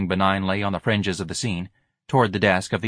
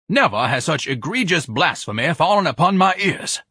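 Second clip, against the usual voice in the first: raised, indignant pronouncement; outraged declaiming; 8 semitones higher, 4 dB louder than usual. Acted. Ignatius offended by the choir's singing, outraged.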